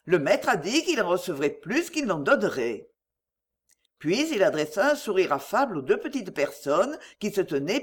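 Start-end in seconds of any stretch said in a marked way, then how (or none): none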